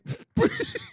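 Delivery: high unvoiced wheezing cackle; evil cackle. Real, not acted. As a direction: cackle like a haunted mummy. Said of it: "the evil cackle of the mummy".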